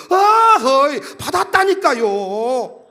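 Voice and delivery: loud exasperated outburst, then drawn-out words; losing his temper. Retold or imitated. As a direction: insist with patience used up. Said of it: "왜 성질 내세요". (답답해서 성질 내는 말투)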